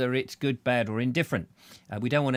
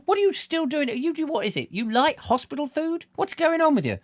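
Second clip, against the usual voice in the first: mock outrage; 13 semitones higher than usual. Teasing a viewer in hospital.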